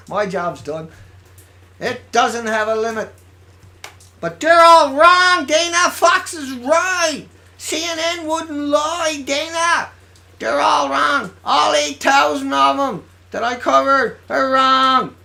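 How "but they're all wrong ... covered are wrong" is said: sarcastic shout. Acted. Sarcastic rant against doubters.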